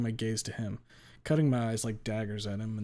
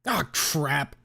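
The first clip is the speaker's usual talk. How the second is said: exasperated, higher-pitched outburst; frustrated cry, raised in pitch; 5 semitones higher, 5 dB louder than usual. Acted. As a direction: burst out in frustration at losing. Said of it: "Damien's frustrated voice cuts her short".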